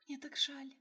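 high, soft whisper. Acted. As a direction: breathe it out in a whisper. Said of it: "прошептала Фэй".

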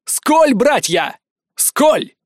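high, stretched-out rallying call; slow, ringing shouted toast; battle cry. Acted. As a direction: call your brothers to cheer. Leader calls a war toast.

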